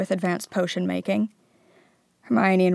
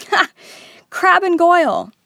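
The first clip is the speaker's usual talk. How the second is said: laughing triumph; 11 semitones higher, 9 dB louder than usual. A narrator's reading. Harry laughs at realization.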